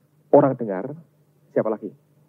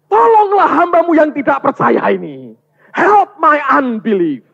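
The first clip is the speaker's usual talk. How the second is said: high, full-voiced cry for help; desperate yelling; 13 semitones higher, 11 dB louder, slower than usual. Father of a tormented son begging Jesus, desperate yell. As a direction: yell the plea, stretching the last words. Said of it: "Terus dia teriak".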